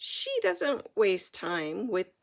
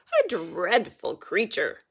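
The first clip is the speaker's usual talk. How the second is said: sniffy queen; 2 semitones lower, 6 dB louder than usual. A narrator's reading. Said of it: "sniffs the queen".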